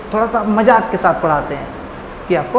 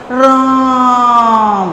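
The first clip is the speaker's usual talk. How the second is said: "राम" cried out long, like someone struck by an arrow; drawn-out cry; 3 semitones higher, 4 dB louder, much slower than usual. Shown as a demonstration. Teacher acting out a wounded man's call: "राम" as a long mock cry. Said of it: "और कोई चिल्ला रहा है".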